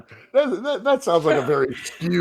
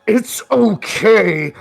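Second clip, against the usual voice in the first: drawn-out, loud soothing words gone beastly; gravelly, angry undertone; 5 semitones higher, 7 dB louder, much slower than usual. Acted. Vampire trying to calm Charles in a borrowed voice, angrily. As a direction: force out comfort with beast anger underneath. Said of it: "gravelly voice that you can only attest".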